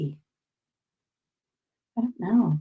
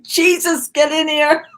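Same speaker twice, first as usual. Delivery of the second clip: high, loud call out the back door; pretend yell; 8 semitones higher, 9 dB louder than usual. Calling a dog named Jesus in from the back door, pretend yell. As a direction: holler the dog in from the yard.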